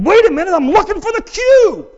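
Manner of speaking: high, loud flustered cry; flustered parent. Retold or imitated. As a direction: play the overwhelmed parent scrambling. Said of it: "you're kinda like going".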